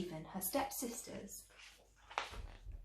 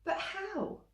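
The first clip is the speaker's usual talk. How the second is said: surprised cry; 11 semitones higher, 3 dB quieter than usual. A narrator's reading. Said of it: "cried Cinderella".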